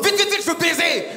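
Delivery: high, rushed, laughing urgency; hammed-up lusty panic; mock frantic horny guy. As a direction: play a desperate man in a hurry. Mocking himself, frantic.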